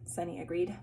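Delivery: plain reading voice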